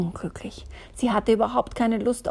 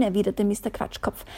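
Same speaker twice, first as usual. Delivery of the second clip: ordinary delivery, nothing marked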